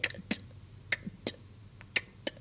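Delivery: "kt" as bare voiceless clicks of K then T; crisp little "kt", no vowel, pause between each; isolated K-T sound. Shown as a demonstration. Teacher isolating the word ending for students, crisp bare "kt". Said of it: "the K T sound".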